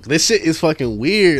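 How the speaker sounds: normal talking voice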